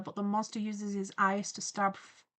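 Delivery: ordinary delivery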